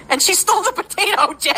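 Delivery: high, laughing story beat; giggly storytelling; laughing disbelief. Real, not acted. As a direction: laugh through the punchline. Telling of child stealing fries.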